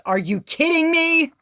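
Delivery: high, loud gasp of disbelief; can't believe the card; stunned squeal; shocked disbelief. Real, not acted. Tarot reader shocked by card.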